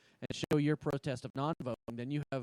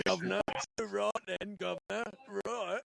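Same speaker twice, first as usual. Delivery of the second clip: hammed-up Cockney caricature, pitched up; mock British accent; 5 semitones higher, slower than usual. Host teasing the British guest about his speech, mock British accent. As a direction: put on a cartoonish London accent. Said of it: "You sound pretty fucking Mary Poppins".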